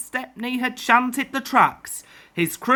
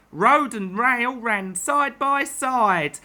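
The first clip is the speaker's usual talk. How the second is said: usual speaking voice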